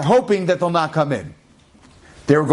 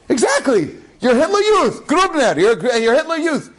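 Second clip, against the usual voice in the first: laughing accusation; 11 semitones higher than usual. Retold or imitated. Soldier reading his papers and laughing at him, high mocking accusation. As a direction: gloat with a high cackling accusation.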